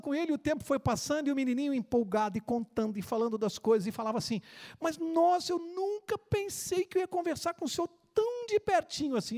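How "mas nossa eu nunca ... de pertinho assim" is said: put-on higher child voice, eager and stumbling; excited little boy. Little boy gushing to the old man on the bench. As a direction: gush like a starstruck kid.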